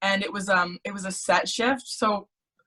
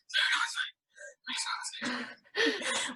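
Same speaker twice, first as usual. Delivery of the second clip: airless, whispered wheeze, very slow; mock breathless; 10 semitones higher, 10 dB quieter, slower than usual. Brushing off friend Sarah's offer, gasping and whispered. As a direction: gasp it out with no voice left. Retelling being too breathless to talk.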